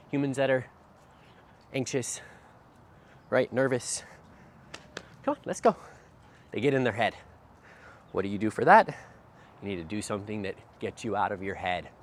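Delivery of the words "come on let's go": high, bright call to the dog; upbeat dog call. Real, not acted. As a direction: cheer the dog on, high and loud. Trainer calling dog along.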